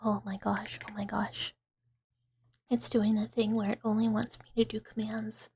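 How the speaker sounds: low, breathy near-whisper; soft ASMR whisper. Real, not acted. Streamer chatting to viewers about gaming, soft ASMR whisper. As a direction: chat softly and low, close to a whisper.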